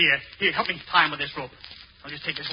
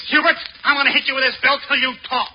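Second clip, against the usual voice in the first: angry threat; 6 semitones higher, 4 dB louder than usual. Acted. Threatening with belt.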